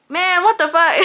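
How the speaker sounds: loud, high, exasperated yell; frustrated outburst. Retold or imitated. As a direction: blurt it out fed up and furious. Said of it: "I was so upset".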